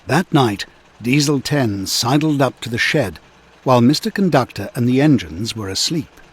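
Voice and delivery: ordinary delivery, nothing marked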